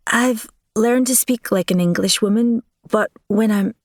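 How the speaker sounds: nervous, let the Scottish creep in; slipping Scottish accent. Acted. Helia falters before the Marquis, faint nervous Scottish lilt. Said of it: "There are times I slip in my speech".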